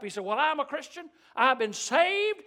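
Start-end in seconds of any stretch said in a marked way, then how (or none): 0.2-1.1 imitating a bold older man, high and loud; eager, forthright. Old man answering a preacher on a plane, quick and forthright. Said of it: "he was real quick to speak up". 1.3-2.4 loud, high proclamation, stretching "saved"; eager, forthright. Retold or imitated. Old man announcing his church membership, right out there with it. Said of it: "just kinda right out there with it".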